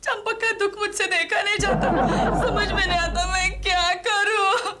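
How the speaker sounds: tearful, sobbing lament; crying. Acted. Woman weeping to husband over Champak's sorrow, crying. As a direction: wail it through the sobs. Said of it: "मैं रो रही हूँ".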